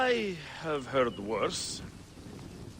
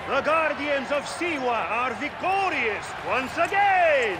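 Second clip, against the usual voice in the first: loud, high, slow proclamation; arena announcer; 9 semitones higher, 4 dB louder, slower than usual. Acted. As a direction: announce victory to the roaring crowd. Announcer hails winners again.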